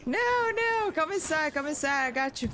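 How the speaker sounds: high-pitched frantic calling, easing slightly; panicked urging. Real, not acted. Player calling teammate inside to cover, panicked urging. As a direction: urge a teammate to safety in a real panic.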